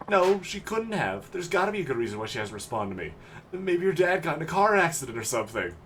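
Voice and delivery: put-on boyish, pleading game character; teenage boy in denial. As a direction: insist like a lovesick kid who won't accept it. Voices the kid in denial.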